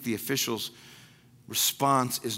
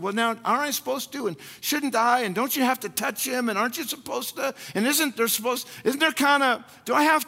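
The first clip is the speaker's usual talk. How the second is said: high, fast, fussy whining questions; mock doubting questions; 10 semitones higher, 3 dB louder than usual. Preacher mimicking a doubter questioning Jesus, high and fussy. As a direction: fret like a doubter asking rules.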